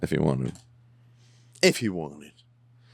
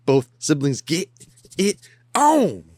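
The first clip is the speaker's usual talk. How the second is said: higher, louder, slower playful tease; teasing sing-song; 9 semitones higher, 4 dB louder, slower than usual. Real, not acted. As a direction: stretch it out to tease the viewers. Teasing repeat of his own line.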